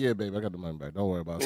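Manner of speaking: low, soft placating reply; acted-out husband brushing off a question; mock reassurance. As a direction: drop low and soft to soothe your wife. Placating wife in retold exchange.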